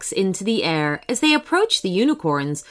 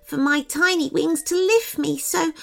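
high-pitched fairy voice, accepting; friendly large fairy; 10 semitones higher than usual. A narrator's reading. Large fairy explaining why he only watches, high voice.

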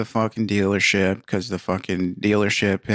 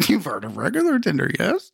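high mocking parrot of the friend; mocking echo; 7 semitones higher than usual. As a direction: parrot it back, much higher. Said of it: "mocking echo".

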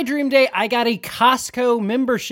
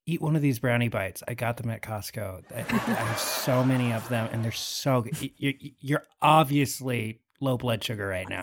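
soothing; 14 semitones lower, 8 dB quieter, faster than usual. Acted. Calming Beef with brownies.

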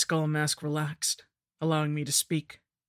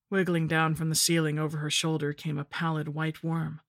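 plain reading voice

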